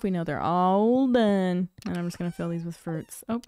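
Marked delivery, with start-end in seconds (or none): none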